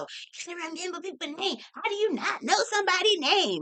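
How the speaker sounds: high scolding commenter voice, mocking. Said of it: "I could just see it now".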